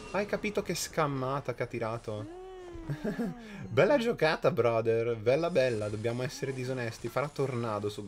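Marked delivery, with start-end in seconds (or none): 2.5-3.6 sudden high burst of laughter. Real, not acted. Laughs at opponent's trick.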